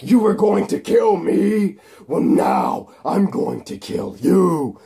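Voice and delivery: villain threat. Acted. Electro threatening Spider-Man.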